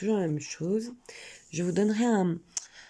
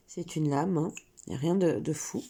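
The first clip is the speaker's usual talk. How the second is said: normal talking voice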